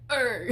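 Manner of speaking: loud mock grunt, breaking into laughter; imitated rapper's grunt; weird grunt. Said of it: "It's just this weird grunt".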